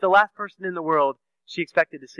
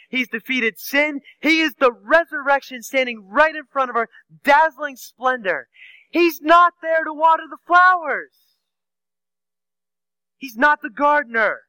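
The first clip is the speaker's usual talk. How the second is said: higher and louder, ringing proclamation; preaching at full force; 8 semitones higher, 5 dB louder than usual. Real, not acted. Preacher proclaiming the risen Christ to the congregation, full force. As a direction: build the triumph, proclaim it to the rafters.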